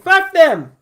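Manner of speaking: high, very loud outburst; defiant shout. Acted. Defiant retort to imagined objection.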